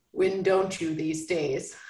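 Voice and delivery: low, slow Southern man's voice; hint of a Southern cowboy; Southern accent. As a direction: drop low and drawl it Southern. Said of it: "I don't know why I'm going Southern with her".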